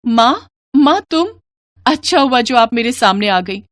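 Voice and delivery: high-pitched daughter, startled then confiding; bewildered daughter. Acted. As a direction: pour out your puzzlement to mother. (ऊँची आवाज़ में हैरान बेटी)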